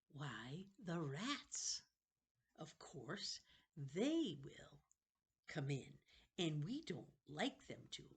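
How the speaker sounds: a wheedling ancient keeper of books; creepy old librarian. Acted. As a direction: play a strange, eager old librarian. Librarian character voice.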